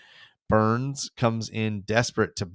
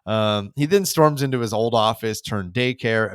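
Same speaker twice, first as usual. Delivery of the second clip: ordinary talk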